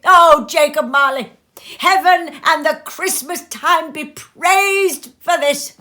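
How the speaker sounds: loud, high, ecstatic pledge; fervent vow. A narrator's reading. Scrooge vowing to Marley, loud and ecstatic. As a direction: swear it on your knees, overjoyed. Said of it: "so fluttered and so glowing".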